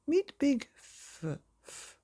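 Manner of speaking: high, slow announcing voice; high slowed announcement cut short; drawn-out introduction. A narrator's reading. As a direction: announce it grandly, then cut off. Interrupted introduction.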